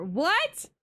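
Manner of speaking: high, loud incredulous yelp; shocked what. Real, not acted. Streamer reacting to chat about maple syrup, shocked high yelp. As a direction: squeak it out in disbelief.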